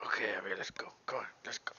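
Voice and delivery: soft unvoiced whisper; whisper to dog. Real, not acted. Whispering to Abby to get her moving. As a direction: hush the dog along quietly.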